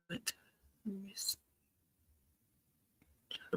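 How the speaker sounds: soft unvoiced whisper. Real, not acted. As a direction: barely breathe the words. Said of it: "I'm sorry I'm whispering".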